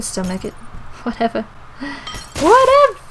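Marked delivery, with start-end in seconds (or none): 2.4-3.0 very high, loud whine; squealed dismissal; exaggerated dismissal. Second take, screamed.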